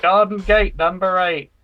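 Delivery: bingo caller. Pretend. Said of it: "can anybody fake a bingo caller".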